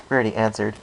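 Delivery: usual speaking voice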